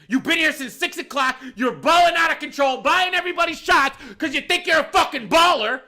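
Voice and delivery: high, loud rant building in outrage; angry tirade. Retold or imitated. Manager to big spender who ran from the bill, indignant tirade. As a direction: yell the whole list of offences at them.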